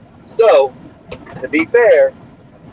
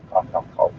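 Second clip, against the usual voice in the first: fake cough; 10 semitones lower, 7 dB quieter than usual.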